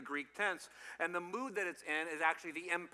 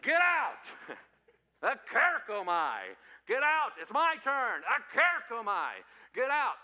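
high, loud sibling yell; full-voiced, hammed-up shouting; playful scream; 5 semitones higher, 9 dB louder than usual. Shown as a demonstration. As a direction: yell it like a kid claiming a room. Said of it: "all you have to do is just scream".

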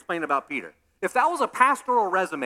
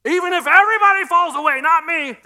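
boastful shout; 9 semitones higher, 8 dB louder than usual. Retold or imitated. Quoting Peter's boast.

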